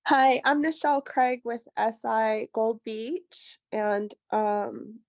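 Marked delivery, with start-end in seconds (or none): none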